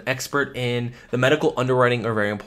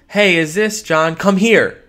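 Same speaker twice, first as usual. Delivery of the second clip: louder, urgent as if something's wrong; alarmed call; 5 semitones higher, 7 dB louder, slower than usual. Shown as a demonstration. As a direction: call out like there's trouble. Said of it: "that tonality expresses there's something wrong".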